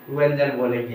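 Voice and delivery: usual speaking voice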